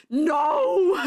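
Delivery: high, loud mock wail; comic yell of refusal; fully distraught yell. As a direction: belt out a hammed-up, distraught "no". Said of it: "I go".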